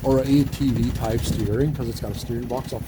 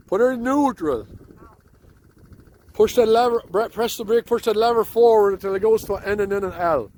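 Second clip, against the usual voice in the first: calling out; 8 semitones higher than usual. Real, not acted. Instructing driver from afar.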